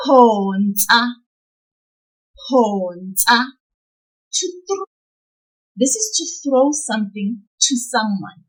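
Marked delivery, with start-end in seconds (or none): 0.1-3.5 Phonsa with a long "Pho"; pulled syllable. Shown as a demonstration. Teacher modelling for learners to copy, Phonsa with "Pho" pulled long. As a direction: draw out the first syllable of Phonsa, end on a short "nsa". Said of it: "We will pull the syllable pho".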